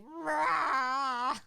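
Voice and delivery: loud high growling roar; monster roar. Retold or imitated. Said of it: "so that's why she's like".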